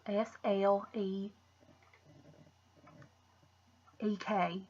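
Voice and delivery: S L E E K spelled slowly, letter by letter. Shown as a demonstration. Vlogger spelling the lipstick shade name letter by letter. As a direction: spell "S L E E K" out one letter at a time. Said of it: "It says Sleek".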